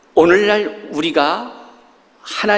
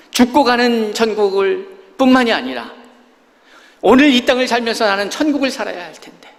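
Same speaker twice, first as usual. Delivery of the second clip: higher, louder fervent preaching; sermon climax, voice raised; impassioned preaching; 8 semitones higher, 4 dB louder than usual. Acted. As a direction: lift the congregation toward heaven. Preaching at full force.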